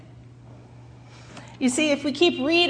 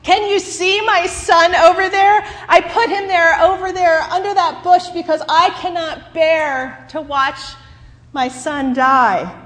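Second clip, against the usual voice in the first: higher and louder, anguished cry; screaming mother; 7 semitones higher, 7 dB louder than usual. Acted. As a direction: scream it at the desert sky. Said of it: "Hagar screamed".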